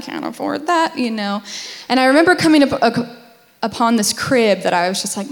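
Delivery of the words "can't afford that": "can't afford that" lifted high but soft; higher resigned repeat. Retold or imitated. Wife retelling her Pinterest wishes, higher wry repeat. Scrolling nursery ideas, repeated.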